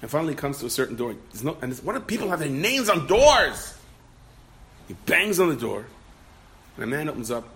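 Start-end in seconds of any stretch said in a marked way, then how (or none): 1.8-3.8 high, loud, slow exasperation; acted frustrated complaint, stretched out and pitched up. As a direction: cry out in mock exasperation. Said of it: "He's very frustrated".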